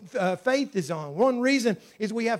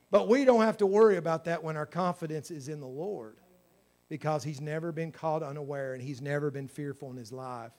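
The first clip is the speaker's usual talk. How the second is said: usual speaking voice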